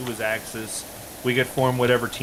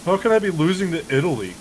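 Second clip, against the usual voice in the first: high, whiny mimic of the friend; mock the opponent's disbelief; high mock-whiny complaint; mock whiny loser; 7 semitones higher, 3 dB louder, faster than usual. Imitating the losing friend.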